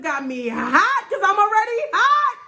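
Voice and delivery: high loud startled cry; startled ooh. Real, not acted. Startled by a car pulling in, real high cry. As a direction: jump at the car in the driveway.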